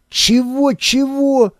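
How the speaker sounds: sudden squeak of alarm; shocked and disbelieving; high startled voice. A narrator's reading. Мыкин shocked on phone. (высокий испуганный голос)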